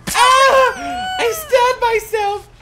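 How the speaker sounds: very high, loud game shriek; panicked shrieking. Pretend. Player startled by a crow, high panicked shriek. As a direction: shriek at every fright, playfully panicked.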